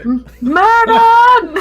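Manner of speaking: calling out a name, high; yelling across the room. Acted. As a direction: shout the name to someone downstairs. Calling Murden from upstairs.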